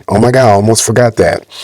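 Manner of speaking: usual speaking voice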